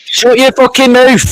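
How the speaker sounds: heated family shouting. Real, not acted. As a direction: bellow it in fury. Shouting match.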